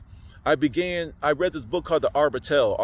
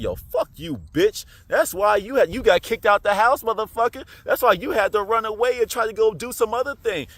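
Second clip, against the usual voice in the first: loud, raised-pitch taunting tirade; cussing out; 6 semitones higher, 4 dB louder than usual. Retold or imitated. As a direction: tell the devil off with swaggering defiance. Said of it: "I started to cuss out the devil".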